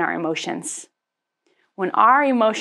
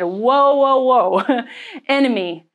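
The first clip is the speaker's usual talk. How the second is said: louder, higher rebuke of the enemy; calling out; 4 semitones higher, 4 dB louder, slower than usual. Retold or imitated. She rebukes the enemy out loud, forceful. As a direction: stop the devil in his tracks. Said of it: "I said".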